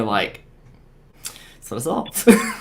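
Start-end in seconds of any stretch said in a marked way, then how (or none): 1.2-2.5 slow, high and rising disbelief; stunned realization. Retold or imitated. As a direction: retell being stunned, stretching up on the last word. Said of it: "I was just stunned there".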